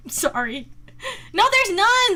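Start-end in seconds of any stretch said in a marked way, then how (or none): 1.3-2.2 high, loud denial; raised-voice objection; indignant protest. Real, not acted. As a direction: shoot the claim down loudly. Disputes chat's claim.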